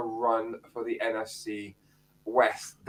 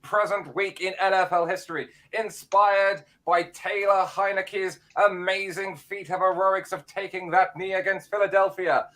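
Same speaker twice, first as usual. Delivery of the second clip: a touch higher, announcer-style history read; segment presenter voice; 4 semitones higher than usual. Acted. Phil does his weekly history feature for the podcast, announcer-style. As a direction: present it like a radio feature, lightly. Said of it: "time for your voice, mate".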